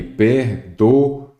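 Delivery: "perdoou" slowly broken syllable by syllable; split into syllables. Shown as a demonstration. Teacher separating the syllables to show the hiatus, slow and segmented. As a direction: say "perdoou" in separate, drawn-out pieces. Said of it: "Quando você separa em sílabas".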